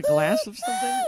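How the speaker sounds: squeaky high joking question; high mock question. Co-host teasing in a silly squeak.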